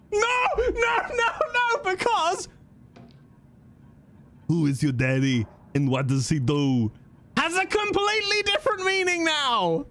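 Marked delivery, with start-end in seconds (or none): 0.1-2.5 shrill high no no no; squealing protest. Real, not acted. Streamer squealing in protest at the line. 7.4-9.8 squeaky aside. Real, not acted.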